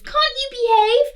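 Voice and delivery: high, loud scolding; furious knight. Acted. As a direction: scold them like an outraged knight. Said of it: "a furious knight".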